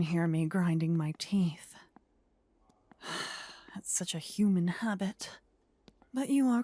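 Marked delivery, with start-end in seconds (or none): 3.0-3.5 soft tired exhale; weary sigh. Acted. Queen sighing over stalled plans, a soft weary sigh. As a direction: let out a quiet fed-up sigh. Venting frustration.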